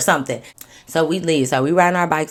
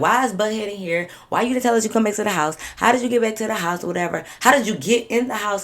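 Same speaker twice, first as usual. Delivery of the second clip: higher, louder barrage of questions; retold interrogation; 5 semitones higher, 4 dB louder than usual. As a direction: fire off indignant questions, reenacted.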